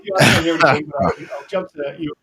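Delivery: boomy echoey room sound; echoey mic. Real, not acted. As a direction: talk through an echoey computer mic. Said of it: "you sounded echoey".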